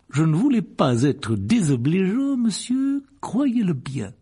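voix aiguë, lente, qui s'excuse vite; empressé et obséquieux. Acted. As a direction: se rattraper avec une courbette vocale. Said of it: "s'empressa d'ajouter". (ton servile et empressé)

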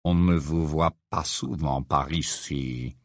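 low, slow, grave-deep voice; sepulchral voice. Acted. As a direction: speak like a voice from the tomb. Morose's gloomy voice.

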